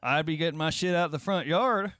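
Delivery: loud, laughing, high; loud laughing outburst. Real, not acted. Friend laughing loudly at being kicked out. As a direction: blurt it out loud through laughter.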